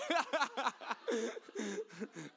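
high, helpless chuckling; real laughing fit. Real, not acted. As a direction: crack up at your own silliness. Laughs at own hillbilly voice.

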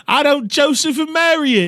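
high, loud mock British voice; British accent. Riffing crude lines with co-host, hammed-up British accent. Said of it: "Can you do a British accent?".

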